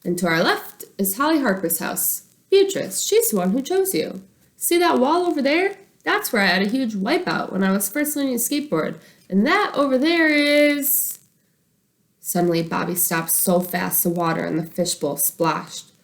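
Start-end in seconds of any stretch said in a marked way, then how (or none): 0.0-11.2 lightly voiced, higher excited tour guide. Boy guiding his fish on a tour, mild tour-guide voice. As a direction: point out sights like a bus guide. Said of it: "like the guide on the red double-decker bus".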